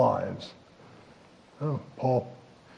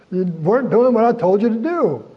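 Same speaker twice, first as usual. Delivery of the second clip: high, loud playful rebuke; teasing scold, pitched up and louder; 8 semitones higher, 6 dB louder than usual. Retold or imitated. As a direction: tell them off with mock sternness. Jesus scolding the fishermen.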